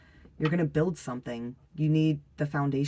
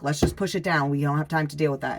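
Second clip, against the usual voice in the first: louder, brisk and dismissive; impatient masculine energy; 7 dB louder than usual. Acted. Masculine energy brushing off the feminine one, impatient. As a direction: push it through with no time to spare. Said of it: "The masculine energy will be like".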